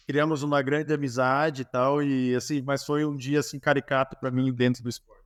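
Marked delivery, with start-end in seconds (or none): none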